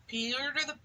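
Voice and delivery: ordinary delivery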